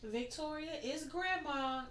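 high, soft little grandma voice; mock grandma on the phone; imitating grandma. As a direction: sweetly announce yourself like an old lady. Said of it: "She was like".

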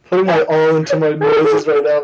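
usual speaking voice

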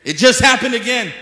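high, loud shout of insistence; emphatic shout. Real, not acted. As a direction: shout it out to the crowd. Repeats the claim, much louder.

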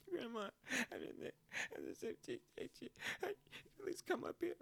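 put-on high, pleading child's voice, with a whispered aside; whiny plea to grandma. Calling grandma from school, pleading like a tearful kid. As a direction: whimper it like a kid begging. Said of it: "So I call my grandma".

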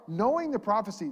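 normal talking voice

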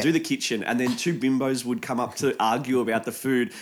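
ordinary talk

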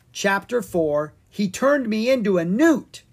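announced high and bright; chapter title. A narrator's reading. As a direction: read out the chapter title with flair.

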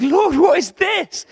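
high, loud wail of pain; anguished cry. Acted. Older people mourning the old temple, anguished cry. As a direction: cry out in acted anguish, drawn out. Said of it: "big cry of just anguish and pain".

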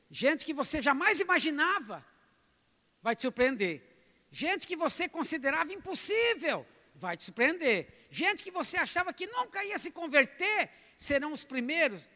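loud, high, building preaching cry; preaching at full force. Acted. Pastor crying out to the congregation, fervent and loud. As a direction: lift the church with rising fervour.

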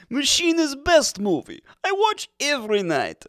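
broken English like a Soviet comrade; Russian accent. Pretend.

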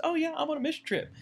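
high, quick, offhand voice; breezy mock volunteer. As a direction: toss it off like a carefree volunteer. Mimics a casual, unprepared volunteer.

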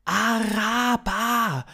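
high, loud, drawn-out alien voice; slow high-pitched extraterrestrial; alien stranger. Acted. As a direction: stretch the word out in a high alien call. Alien repeats his utterance.

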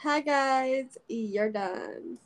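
hi guys, you're done. Shown as a demonstration. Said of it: "That's how I would say it".

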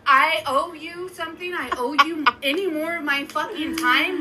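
heated rant. Retold or imitated. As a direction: tear into the ex. Angry rant at ex.

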